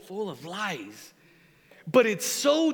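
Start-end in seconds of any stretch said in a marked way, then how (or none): 0.0-1.1 quiet quoted phrase. Retold or imitated. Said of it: "you're doing, like".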